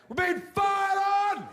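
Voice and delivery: very high, slow panicked shout; freaked-out yell. Retold or imitated. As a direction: scream it as if bullets are flying. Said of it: "I freak out".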